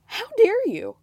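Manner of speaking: high, loud hammed-up indignation; mock outrage. Viewer in mock offense at the wife. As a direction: clutch your pearls.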